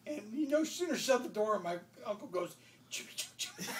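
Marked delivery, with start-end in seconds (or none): 2.9-3.8 voiceless, giggly whisper; barely suppressed laughing whisper; imitated sneaky taunt. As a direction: whisper it cheekily behind a shut door. Uncle sneaks the line in.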